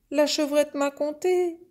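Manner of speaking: high-pitched little calf tattling; complaining calf. Acted. Calf telling mother on the little goat, high complaining voice. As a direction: whine it up high like a youngster.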